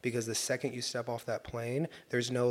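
ordinary delivery

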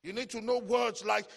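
ordinary delivery

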